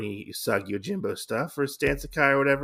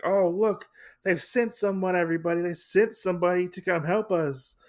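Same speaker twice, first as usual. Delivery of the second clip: excited villager; 8 semitones higher than usual. Retold or imitated. Villager excited Usagi arrived.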